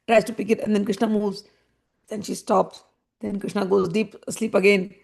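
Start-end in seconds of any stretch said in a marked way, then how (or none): none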